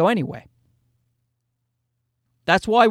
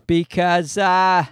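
ordinary talk

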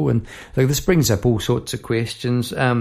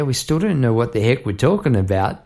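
ordinary talk, nothing marked

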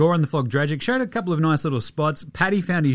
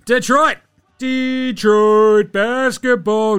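hype shout; 8 semitones higher, 7 dB louder, much slower than usual. Pretend. Hyping the Pistons win.

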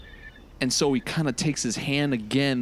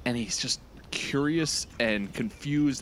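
normal talking voice

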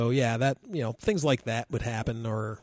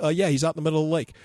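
offhand; 4 semitones higher, much faster than usual. Retold or imitated. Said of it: "We're like".

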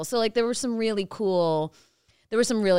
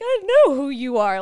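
louder, higher, gushing fan reply; starstruck retort; 7 semitones higher, 8 dB louder than usual. Retold or imitated. Starstruck reply to famous musician.

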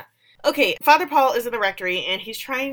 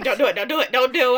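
retold frantic plea, raised and urgent; mock screaming; 5 semitones higher, 5 dB louder, faster than usual. Viewer begging the character not to hug, mock screamed plea. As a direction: plead at the screen, getting louder. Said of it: "And I'm screaming".

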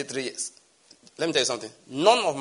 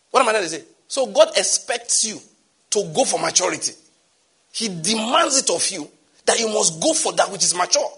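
raised, higher preaching voice; 6 semitones higher, 4 dB louder than usual. Acted. Pastor pressing the church toward maturity, full preaching voice. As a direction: preach it out to the hall.

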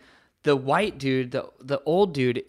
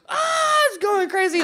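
much higher, mock panicked squeal; freaked-out passengers; 5 dB louder than usual. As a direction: imitate the whole cabin losing it.

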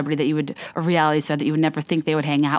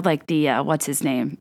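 ordinary delivery

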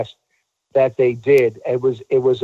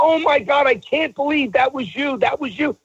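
retold amazement, very high-pitched; 12 semitones higher than usual. Actor retelling a star recognizing him on the phone, amazed. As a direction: imitate an astonished fan, voice way up.

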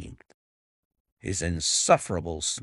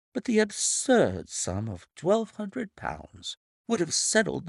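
higher, silky villain voice; smug blackmailer voice. Acted. Narrator voices Milverton.

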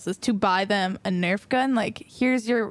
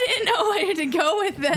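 talking through giggles; laughing; 8 semitones higher than usual. Real, not acted. Laughing after the bit.

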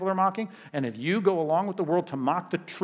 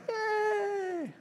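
high squeaky mock "Yay!"; a sarcastic cheer, pitched way up; 5 dB quieter than usual. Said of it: "no one's like".

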